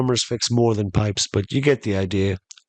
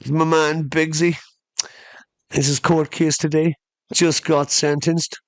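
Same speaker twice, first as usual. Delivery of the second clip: put-on boasting kid; 6 semitones higher than usual. Comic voicing the kid boasting about his friend. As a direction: brag about the friend's sentence. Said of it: "imitating the kid's boast".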